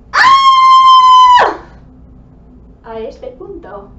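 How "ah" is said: long, very high, loud scream; sustained full-volume shriek on "ah". Shown as a demonstration. Teacher acts out the command to scream, piercing high scream.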